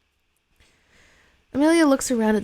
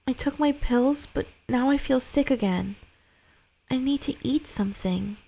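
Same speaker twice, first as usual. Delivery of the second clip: put-on higher little boy voice, pleading; young boy; 6 semitones higher than usual. Sick son asking his mother for food, small higher boy voice. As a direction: play the son, small and needy.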